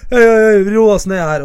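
loud imitation of intervening friends; mates breaking it up. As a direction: step in and calm a mock fight. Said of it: "så kommer kompisene dine og bare".

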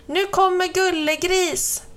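put-on high, excited children's call. A narrator's reading. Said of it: "kom de springande och ropade".

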